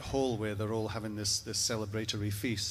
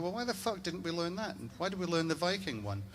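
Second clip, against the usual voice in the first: higher, outraged rhetorical questions; indignant disbelief; 8 semitones higher, 3 dB quieter than usual. Retold or imitated. The comic learns of the Scottish version, higher indignant outburst. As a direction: demand to know why you were cheated. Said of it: "and I thought".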